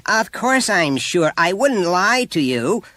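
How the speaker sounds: high, loud, drawn-out indignation; insist, affronted at being doubted; indignant. A narrator's reading. Mother doubted by the teacher, indignant.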